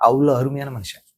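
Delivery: ordinary talk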